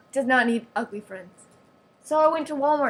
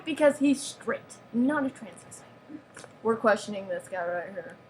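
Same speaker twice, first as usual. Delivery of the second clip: usual speaking voice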